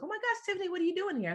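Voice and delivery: high, gushing "oh my gosh" greeting; high-pitched excited greeting; gushing acquaintance. Retold or imitated.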